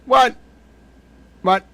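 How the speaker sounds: sudden high startled "What?". Real, not acted. Streamer reading a claim, caught off guard. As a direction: jolt up in real surprise at the screen.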